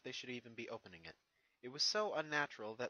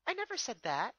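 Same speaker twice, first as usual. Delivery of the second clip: higher, lightly voiced young mare; a thoughtful pony princess, pitched up; Twilight's voice; 11 semitones higher, 8 dB louder than usual. Twilight answers Spike, lightly voiced and higher.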